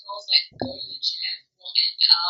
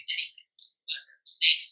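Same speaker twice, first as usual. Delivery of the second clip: ordinary talk, nothing marked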